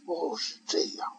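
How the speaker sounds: soft, slow, breathy reply; meek whisper. Acted. Ikkyu answering his master, slow and hushed. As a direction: whisper like a sly little novice.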